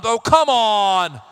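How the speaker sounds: slow, drawn-out laughing complaint; exasperated groan. Real, not acted. Seeing Born in Iran in the passport, slow exasperated groan. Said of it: "Born in Iran".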